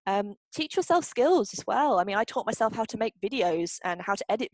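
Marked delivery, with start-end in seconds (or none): none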